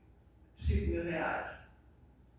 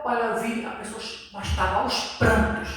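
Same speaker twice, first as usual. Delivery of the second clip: ordinary talk, nothing marked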